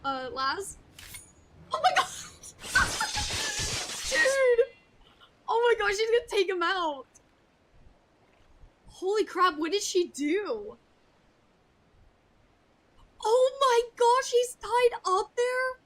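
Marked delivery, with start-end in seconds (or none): 1.7-7.0 high, loud laugh and shout of disbelief; real, helpless laughter; shrieking laughter. Real, not acted. Streamer laughing, shocked at scene. 8.9-15.8 loud, slow, high astonished cry; real amazement; stunned disbelief. Real, not acted. Streamer shocked by punishment.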